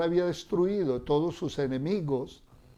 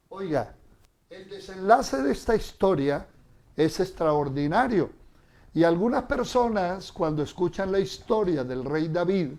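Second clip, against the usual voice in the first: ordinary talk, nothing marked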